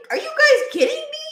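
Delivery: high, laughing disbelief; are you kidding me, half laughing; incredulous. Real, not acted. Ranting to viewers, high incredulous laugh.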